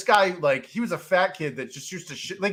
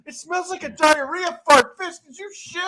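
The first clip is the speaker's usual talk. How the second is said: accusing disgust; 10 semitones higher, 4 dB louder than usual. Retold or imitated. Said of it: "And he's like".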